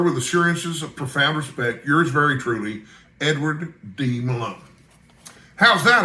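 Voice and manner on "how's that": higher, louder proud question; pleased, raised triumphant ask. A narrator's reading. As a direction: crow over the finished letter. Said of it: "I asked triumphantly".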